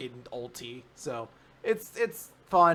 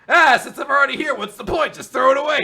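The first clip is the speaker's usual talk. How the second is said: careless loud brush-off; 11 semitones higher, 14 dB louder, faster than usual. Retold or imitated. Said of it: "showed up and be like".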